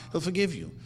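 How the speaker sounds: ordinary delivery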